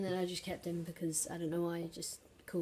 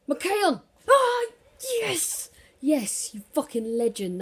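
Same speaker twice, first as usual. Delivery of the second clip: screaming with joy, much higher and louder; goal celebration; 8 semitones higher, 12 dB louder, slower than usual. Real, not acted. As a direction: yell it out like you just scored a winner. Scores a goal in FIFA.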